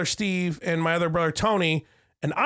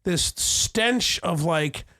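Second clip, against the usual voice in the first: drawn-out disgust; 3 semitones lower, slower than usual. Real, not acted. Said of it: "savoring gross description".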